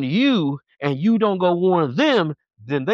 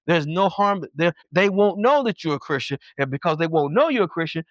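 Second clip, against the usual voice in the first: normal talking voice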